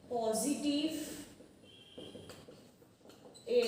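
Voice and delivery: slow, word by word with long gaps; writing-along pace. Real, not acted. Teacher writing the rule as she says it, halting and drawn out. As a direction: dictate it piecemeal while writing. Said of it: "বলার সাথে সাথে লিখছি ওটা".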